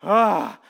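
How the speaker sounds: loud exasperated ah; frustrated groan. Retold or imitated. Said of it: "he's just like".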